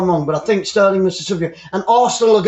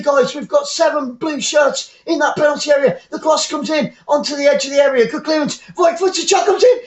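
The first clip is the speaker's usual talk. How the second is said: live play-by-play; 7 semitones higher than usual. Real, not acted.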